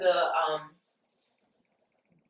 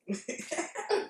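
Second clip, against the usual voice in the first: cheesy pickup line; 9 semitones higher, 9 dB quieter, much slower than usual. Retold or imitated. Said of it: "I'm like".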